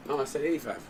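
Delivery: breaking-up voice; voice cutting out. Real, not acted. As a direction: voice dropping in and out. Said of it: "my voice is petering right now".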